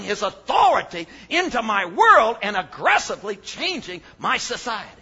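loud preaching, big falling emphasis on key words; full-force preaching. Acted. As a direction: preach it to the back row, hammering each point. Preacher driving home his point.

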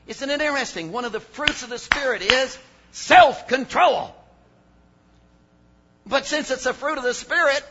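loud preaching, big falling emphasis on key words; full-force preaching. Acted. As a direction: preach it to the back row, hammering each point. Preacher driving home his point.

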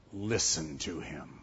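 quiet, low words set apart by silence; hushed solemn command. Acted. Voice from the cloud to the disciples, hushed and solemn. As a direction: drop very low and soft.

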